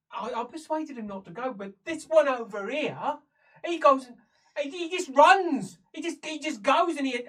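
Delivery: high, loud, stumbling explanation; flustered prisoner. Acted. Prisoner explaining how it all went wrong, flustered and loud. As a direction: get worked up as you explain.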